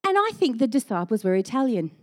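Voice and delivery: usual speaking voice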